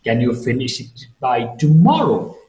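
doubtful punch on the middle of "tomorrow"; rise-fall of doubt. Shown as a demonstration. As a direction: swoop up and down on "tomorrow". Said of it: "M is to be marked with rising, falling".

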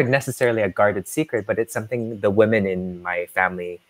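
usual speaking voice

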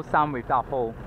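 usual speaking voice

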